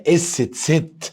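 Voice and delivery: اس ست drawn out very slowly; slow model pronunciation. Shown as a demonstration. Teacher modelling the German letter name for Abu Hamid. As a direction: stretch اس ست sound by sound. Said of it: "قول معايا".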